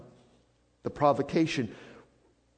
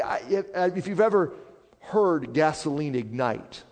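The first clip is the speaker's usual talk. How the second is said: usual speaking voice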